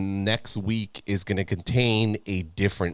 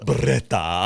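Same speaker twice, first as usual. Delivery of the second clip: put-on Italian accent. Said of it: "Italian accent".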